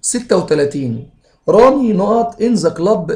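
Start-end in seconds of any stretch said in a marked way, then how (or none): 1.5-3.2 slow, raised, word by word; slow dictation. Shown as a demonstration. Teacher dictating an exam sentence to students, slow and a bit raised. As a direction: read the exam line out slowly for the class. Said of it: "teacher reads exam sentence".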